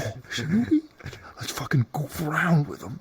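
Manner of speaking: mischievous hushed whisper. Retold or imitated. Friend plotting to mess with the newbie, whispering.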